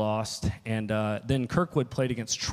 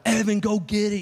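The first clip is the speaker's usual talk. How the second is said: high, loud mock parent yell; imitated sideline cheer; 10 semitones higher, 5 dB louder than usual. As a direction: call out like a parent in the stands. Imitating parents calling from stands.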